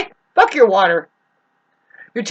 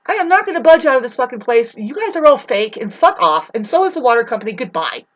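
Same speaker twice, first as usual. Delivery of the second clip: defiant rant; 3 semitones higher than usual. Real, not acted. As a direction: dig in and tell them off. Defiant rant at water company.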